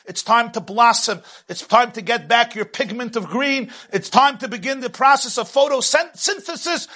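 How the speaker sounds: rising, fired-up preaching voice; lifted sermon delivery calling people out. Acted. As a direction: preach it high with growing fervor. God calls to emerge.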